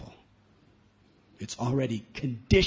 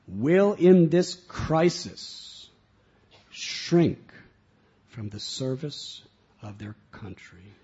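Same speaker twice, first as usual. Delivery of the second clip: slow, much louder oratory; solemn reading; 7 dB louder, slower than usual. Real, not acted. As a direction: declaim like a rousing speech. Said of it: "Do I have your permission to read?".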